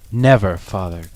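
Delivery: eager cry. Acted. Children promise their father never, crying out. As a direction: call it out together, earnest. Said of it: "cried they all".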